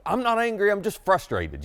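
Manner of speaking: high, loud, defensive denial; loud protest. Retold or imitated. Husband protesting to his wife that he isn't angry, loud and defensive. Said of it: "I protest very loudly".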